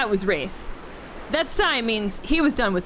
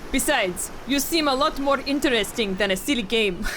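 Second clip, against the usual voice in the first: weak attempt at a Russian accent; 3 dB quieter than usual. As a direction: try for Russian, barely landing. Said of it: "I can't do Russian".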